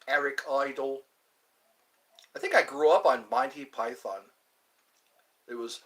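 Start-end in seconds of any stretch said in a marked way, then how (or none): none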